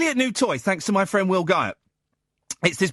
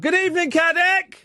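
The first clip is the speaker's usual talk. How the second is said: high-pitched, called-out greeting; a touch of Dalek; 9 semitones higher, 3 dB louder than usual. Real, not acted. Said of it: "You sound like a Dalek".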